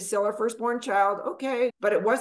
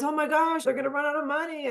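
mock panicked client; 6 semitones higher than usual. Mocking worried lower-end clients.

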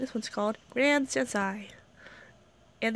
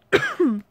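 cough; 8 semitones higher, 8 dB louder than usual. Real, not acted.

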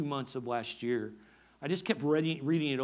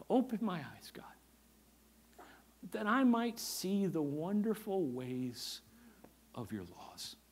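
soft prayer; 6 dB quieter than usual. Acted. Praying the psalm aloud.

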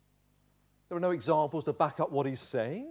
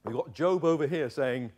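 ordinary talk, nothing marked

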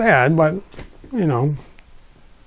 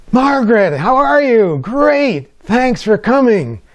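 gushing hostess greeting; 10 semitones higher, 3 dB louder, slower than usual. Retold or imitated. Mother greets guest at door.

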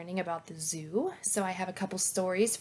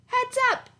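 high bright call of heads up; 7 dB louder than usual. Acted. Elephant calling to friends before kicking the ball, high bright call. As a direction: warn the playmates with a lively high call.

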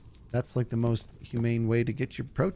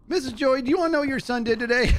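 higher, louder, fast tattle call; gleeful tattling; 12 semitones higher, 3 dB louder than usual. Retold or imitated. Telling his friend's mom at dinner, gleeful tattling shout.

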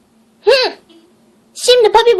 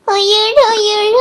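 normal talking voice

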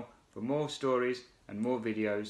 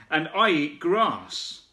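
slow, louder kangaroo voice; bouncy kangaroo voice; 5 semitones higher, 4 dB louder, slower than usual. Acted. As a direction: bounce it out proudly, slowly. Kangaroo character answers.

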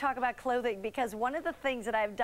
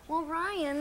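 usual speaking voice

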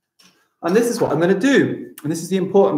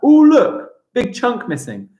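mock amazement; 9 semitones higher, 4 dB louder than usual. Big chunk cut out.

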